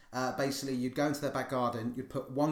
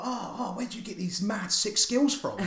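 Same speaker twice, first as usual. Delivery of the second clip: high-pitched mock awe; imitated impressed schoolmates; 7 semitones higher than usual. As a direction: gush like amazed kids in the playground. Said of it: "And people were like".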